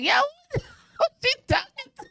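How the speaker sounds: high giggly who-does-she-think-she-is; laughing mock outrage. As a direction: squeal it out in laughing disbelief. Retelling her own shocked reaction.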